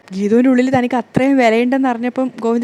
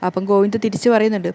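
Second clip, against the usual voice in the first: usual speaking voice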